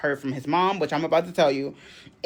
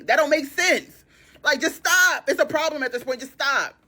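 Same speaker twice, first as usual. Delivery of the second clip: exasperated scolding; 11 semitones higher than usual. Retold or imitated. Scolding the boyfriend.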